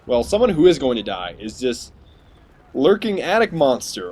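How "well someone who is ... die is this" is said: ordinary talk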